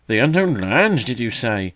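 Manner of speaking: loud, high teasing mock gravity; mock solemn. A narrator's reading. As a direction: play at great seriousness to tease. Magpie teasing the young bird.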